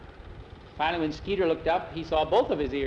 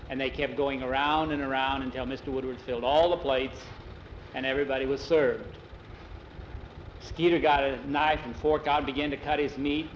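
plain reading voice

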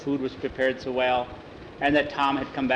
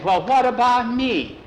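whiny Skeeter; 9 semitones higher, 5 dB louder than usual. Acted. Skeeter feeling left out.

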